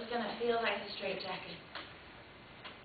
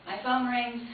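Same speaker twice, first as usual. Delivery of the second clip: ordinary talk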